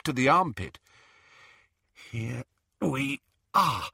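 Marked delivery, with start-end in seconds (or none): 2.1-3.9 slow, strained through gritted teeth. A narrator's reading. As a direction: force it out with teeth clenched. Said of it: "she said between gritted teeth".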